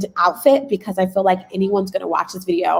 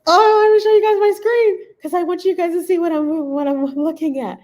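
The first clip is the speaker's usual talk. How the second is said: high, tearful rush of excitement; bursting to share big news; overwhelmed, near tears; 9 semitones higher than usual. Real, not acted. Creator to viewers, high and overwhelmed with joy. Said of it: "I'm about to cry".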